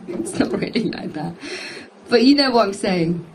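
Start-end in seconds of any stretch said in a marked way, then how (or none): 0.1-1.3 quiet chuckle through the words; laughing softly. Real, not acted. Laughing at own comparison.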